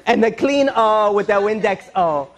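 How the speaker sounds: slow fake Spanish with tacked-on O; drawn-out mock Spanish instructions; bad Spanish accent. Employer instructing the maid, bad Spanish accent. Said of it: "They just throw O at everything".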